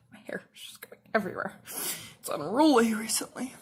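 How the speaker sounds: quick whisper to herself; whispered aside. Real, not acted. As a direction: mutter it under your breath, fast. Muttering about her hair.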